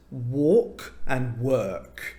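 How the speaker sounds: "walk" and "work" said slowly, each vowel distinct; distinct English vowels. Shown as a demonstration. Tutor showing Russian learners two tricky English words, slow and distinct. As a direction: draw out "walk" and "work" to show their different vowels. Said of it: "vowels that sound much different to Russian vowels".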